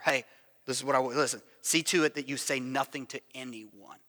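low, quiet stern command. Acted. Jesus sternly orders the healed leper to keep silent. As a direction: say it sternly in a hushed big-boy voice. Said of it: "he's using his big boy voice".

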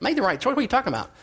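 scoffing at a new dad's doubts; incredulous. Retold or imitated. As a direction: act like the idea is absurd. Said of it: "I'm like".

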